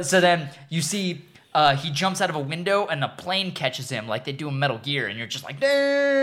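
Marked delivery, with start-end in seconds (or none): 5.6-6.2 mock amazed damn. Amazed reaction to stunt.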